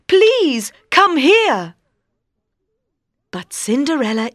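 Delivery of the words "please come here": pleading prince. A narrator's reading. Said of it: "he says".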